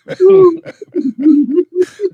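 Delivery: loud wheezing laugh, almost crying; helpless howling laugh; crying laughter. Real, not acted. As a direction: laugh until it hurts. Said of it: "I'm crying".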